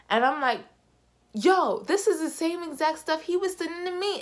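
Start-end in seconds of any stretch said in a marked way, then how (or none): 1.3-4.2 much higher, exasperated outburst; heated. Retold or imitated. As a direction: blurt it out heated and high. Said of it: "So I'm heated".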